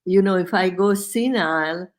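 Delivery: usual speaking voice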